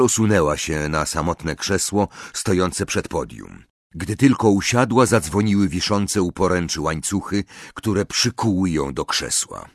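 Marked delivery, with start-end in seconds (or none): none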